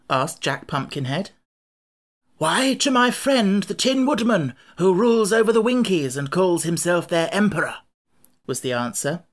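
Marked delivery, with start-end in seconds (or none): 2.4-7.8 lightly voiced king, pitched up; Scarecrow, confident. Scarecrow names his friend the Tin Woodman, confidently. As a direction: answer readily in a raised character voice.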